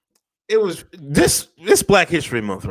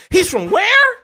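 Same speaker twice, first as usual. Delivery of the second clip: squeaky, much higher, louder disbelief; mock surprise; 6 dB louder, slower than usual. Friend hears the rapper is British, high mock surprise. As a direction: act stunned that he's British.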